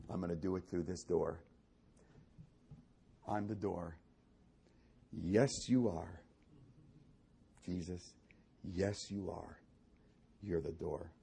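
low, soft, with long pauses; hushed reverent preaching. Real, not acted. Preacher answering God at the sermon's close, hushed and reverent.

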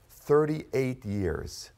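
very slow, weighty repeat; drawn-out echo; slow emphasis. Acted. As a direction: let each word land.

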